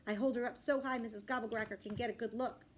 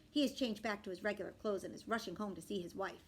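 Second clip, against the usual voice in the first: ordinary delivery, nothing marked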